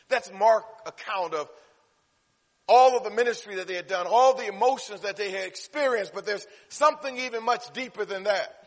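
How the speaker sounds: loud, high, driving sermon delivery; forceful preaching. Real, not acted. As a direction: preach it out to the whole congregation. Preacher building at full force.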